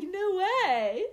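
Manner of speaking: high, loud put-on disbelief; mock amazement. American humouring a Canadian's boast, mock amazement. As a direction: act hugely impressed for their sake.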